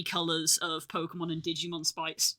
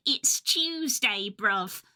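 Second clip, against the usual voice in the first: mock British; 2 semitones higher, much slower than usual. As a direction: parody a British accent, stretching it out. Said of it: "British people be like".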